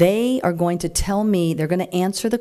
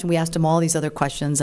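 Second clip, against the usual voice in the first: ordinary talk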